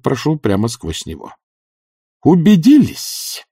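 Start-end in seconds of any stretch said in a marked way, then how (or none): 2.2-3.4 high, slow announcement with satisfaction; strange satisfaction. A narrator's reading. Witch has kicked through the fake bush, announcing with satisfaction. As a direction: proclaim it to the world, gratified. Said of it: "в голосе её звучало странное удовлетворение".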